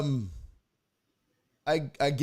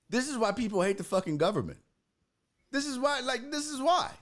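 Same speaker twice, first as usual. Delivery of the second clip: exasperated; 9 semitones higher than usual. Retold or imitated. Ranting about the government.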